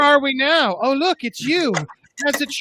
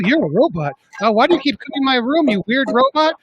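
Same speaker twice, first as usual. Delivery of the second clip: ordinary talk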